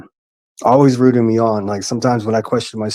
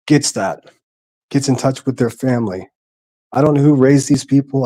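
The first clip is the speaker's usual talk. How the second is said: ordinary delivery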